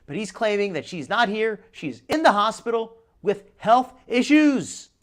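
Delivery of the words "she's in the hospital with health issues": slow, louder skeptical retelling; pointedly slow, higher mock announcement; mock emphasis. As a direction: draw out the claim as if doubting it. Sceptically repeats the caller's claim.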